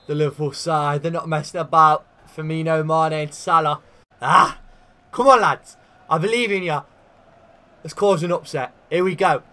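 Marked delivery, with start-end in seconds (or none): 4.2-9.4 loud, high rallying cheer to the lads; rallying cry. Real, not acted. Manager rallying his lads before Wembley, loud excited cheer. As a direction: fire up the team, loud and high.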